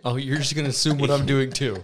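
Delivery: loud mock-offended protest; indignant outburst. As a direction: fire it back, stung and indignant. [name] indignant at being assumed.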